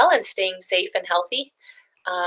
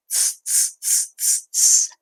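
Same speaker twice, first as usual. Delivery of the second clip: whispered tss of teasing monkeys; monkey tsk; 3 dB quieter, slower than usual. Acted. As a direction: hiss tss back like cheeky monkeys. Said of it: "back at him and said".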